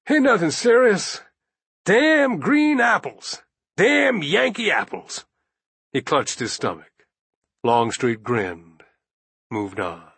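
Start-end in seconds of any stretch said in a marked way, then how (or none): 0.1-5.2 put-on high, strained old soldier voice; gasping sick old man. A narrator's reading. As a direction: gasp it out through a bellyache. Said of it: "He gasped".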